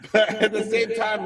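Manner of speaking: laughing. Real, not acted. Laughing mid-story at himself.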